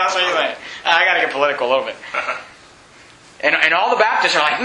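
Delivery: ordinary delivery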